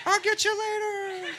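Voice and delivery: high, drawn-out far-off call; distant disease. Acted. Disease promises to catch the smoker later, high distant call. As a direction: call out slowly in a high, faraway voice. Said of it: "the disease was fucking way out there".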